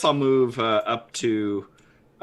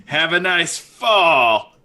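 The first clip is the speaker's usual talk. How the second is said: slow, smug pun delivery; quippy one-liner; 6 semitones higher, 5 dB louder, much slower than usual. Acted. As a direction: savor the pun, slowly. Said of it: "And I say".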